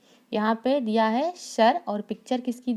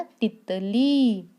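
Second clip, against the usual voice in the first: slow word; much slower than usual. Shown as a demonstration. Teacher reveals the word to children, very slowly. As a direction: draw out तितली very slowly. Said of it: "क्या हो जाएगा".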